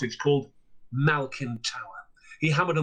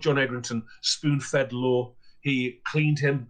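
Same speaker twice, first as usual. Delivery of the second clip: ordinary delivery, nothing marked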